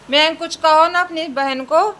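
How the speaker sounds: loud, high coaxing call to her son. Real, not acted. Mother loudly urges son to speak to his sister, high call.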